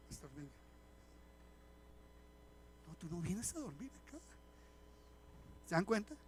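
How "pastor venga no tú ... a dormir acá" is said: much softer, hushed plea; hushed call. Retold or imitated. Congregant quietly calling the pastor over, hushed. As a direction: murmur it so the service isn't disturbed.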